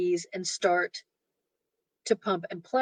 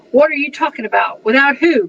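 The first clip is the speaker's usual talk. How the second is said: indignant cry; 6 semitones higher, 11 dB louder than usual. A narrator's reading. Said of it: "cried William Wallace".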